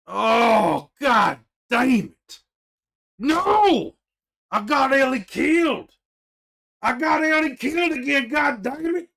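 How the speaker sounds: loud, high furious yelling; real gamer rage, shouted high; raging shout. Real, not acted. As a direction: blow up at the loss. Ellie dies, he rages.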